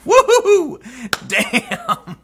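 high gleeful whooping laugh; laughing then a gasped damn. Real, not acted. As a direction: whoop with delight at the zinger. Laughing at the statement's jab.

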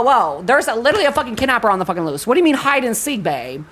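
normal talking voice

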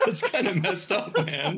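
said through laughter; laughing. Real, not acted. Player laughs at Mouse's rudeness to the librarian. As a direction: laugh while scolding a friend.

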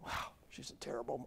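unvoiced, hushed aside; whispered judgment. Preacher mocking a judging shopper, whispered. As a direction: breathe it as a private snide thought. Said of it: "You ever look at that woman and say".

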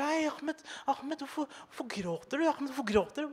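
high, soft sugary concern; mock soothing teacher. The teacher soothes the crying boy in a corner, high and soft. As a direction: coo it gently to a crying child.

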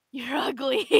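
loud mock-mean yell; hammed-up loud taunt; shouted insult. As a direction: yell it straight at them.

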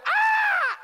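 piercingly high, loud comic scream. Acted. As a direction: shriek as the hot wax comes. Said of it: "I'm screaming".

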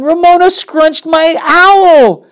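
high, loud, slow wail of a name; crying out. Acted. Susan crying out to the teacher, high and loud. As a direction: call the teacher in outrage. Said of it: "cried Susan".

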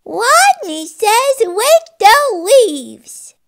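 high, loud, slow rodent voice; drawn-out confident rodent call; stronger squeak. Acted. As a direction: squeak the command out boldly, w for r. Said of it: "Wadney's voice was stronger".

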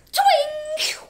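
high, loud twing of a bowstring; twanging bowstring. Acted. Teacher imitates the bowstring during the story. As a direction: snap out the twang of a bow.

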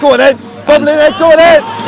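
loud, high encouragement; yelling at a player to go for it; urging shout. Real, not acted. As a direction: drive the player on.

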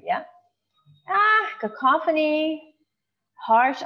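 usual speaking voice

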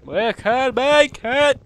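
high, loud, drawn-out shout; hammed-up rallying cry. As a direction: stretch every word into a battle cry. Cheering himself on in game.

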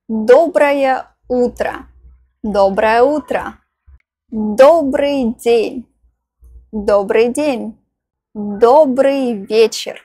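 ordinary delivery

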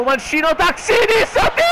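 very high, loud disbelieving shout; ecstatic goal scream. Real, not acted. (urlo di esultanza incredula)

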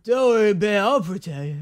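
slurred Stallone-style tough guy; Lasagna's cool guy voice. Retold or imitated. Mocking a character reassuring his bae, slurred cool guy voice. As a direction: mumble it like a drugged-up Stallone. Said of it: "Sylvester Stallone, like, hopped up on morphine".

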